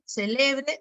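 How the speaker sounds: "celebre" hit on the middle syllable; stress on LE of celebre. Shown as a demonstration. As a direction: lean on LE in "celebre". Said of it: "el acento cumple función distintiva". (acento en LE de «celebre»)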